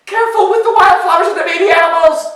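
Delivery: high, loud, fast cry; calling out. A narrator's reading. Ogre calling after departing goat, high and urgent. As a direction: shout the warning after them in a little voice. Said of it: "little ogre called out after him".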